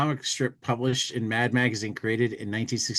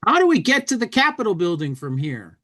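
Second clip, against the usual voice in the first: high, loud, quick tourist voice; comic bubble voice; 7 semitones higher, 4 dB louder, faster than usual. Acted. Reading a comic bubble aloud.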